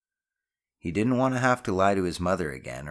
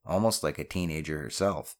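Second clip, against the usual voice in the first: usual speaking voice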